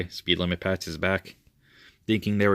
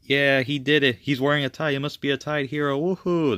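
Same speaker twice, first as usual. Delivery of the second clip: high, excited acted cheering; cheering crowd; 7 semitones higher than usual. Townspeople cheering for Freddy, high and gleeful. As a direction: hail the hero in a high, celebratory voice.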